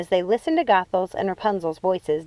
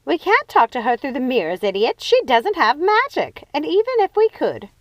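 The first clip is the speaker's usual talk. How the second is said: Lucinda's voice; 6 semitones higher, 5 dB louder than usual. Acted. Lucinda scolding.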